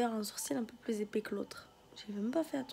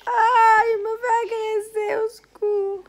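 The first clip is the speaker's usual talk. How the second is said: high, loud squealing cries; being tickled; 12 semitones higher, 16 dB louder, slower than usual. Real, not acted. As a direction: squeal for help while tickled. Child being tickled, crying out.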